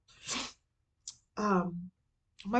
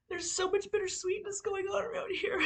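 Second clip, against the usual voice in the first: crying; 10 semitones higher, 3 dB quieter than usual. Real, not acted. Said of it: "I'm sorry I'm crying so much".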